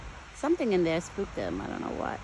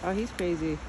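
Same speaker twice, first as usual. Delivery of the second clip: normal talking voice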